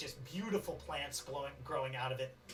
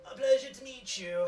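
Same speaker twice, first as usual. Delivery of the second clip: higher, louder pompous hello; mayor tugging mustache, pompous and raised; the mayor's greeting; 7 semitones higher, 6 dB louder than usual. Acted. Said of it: "the mayor pulls on their mustache and goes".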